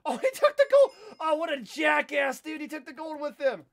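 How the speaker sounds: loud, high indignant shouting at a teammate; outraged yelling. Real, not acted. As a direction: yell at the thief who ran off with the gold. Teammate steals the gold.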